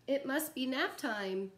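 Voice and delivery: slow, gentle storybook read with long pauses; reading to children. Acted. Teacher reading a bedtime picture book to kids, slow and gentle. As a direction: lull the little ones toward nap time.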